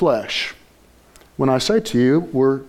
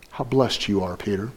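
soft, much quieter and lower; tender blessing; 7 semitones lower, 7 dB quieter than usual. Acted. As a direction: bless him gently, almost hushed. Jesus blessing Peter tenderly.

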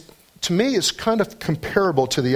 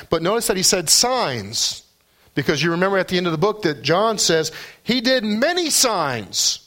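raised, fervent preaching voice; 9 semitones higher than usual. Acted. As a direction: lift the voice and preach it out. Preaching passage on signs.

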